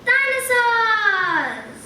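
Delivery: slow, high, loud cry of wonder; drawn-out joyful exclamation; amazed cry. Acted. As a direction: let the amazement ring out. Boys stare in amazement.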